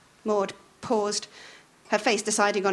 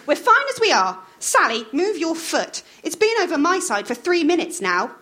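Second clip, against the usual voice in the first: higher, louder scolding wife; bossy wife snapping at Roy; Maud scolding; 8 semitones higher, 4 dB louder than usual. A narrator's reading. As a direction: nag him to hurry up. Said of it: "said Maud".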